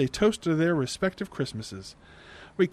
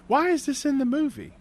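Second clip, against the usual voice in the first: baffled; 10 semitones higher than usual. Retold or imitated. Narrator's own baffled outburst.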